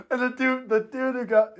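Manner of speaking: normal talking voice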